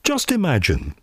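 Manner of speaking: ordinary talk, nothing marked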